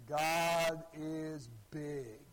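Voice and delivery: hugely drawn-out proclamation; imitated preacher. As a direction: mimic a preacher stretching every word very slowly. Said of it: "a little bit more excited".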